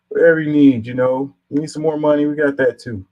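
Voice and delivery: high, loud mock benefactor; exaggerated eager-to-please giveaway voice; mock generosity. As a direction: offer the moon, sarcastically. Mocking careless givers of free money.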